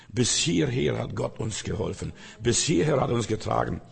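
ordinary talk

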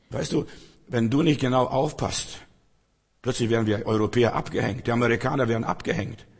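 usual speaking voice

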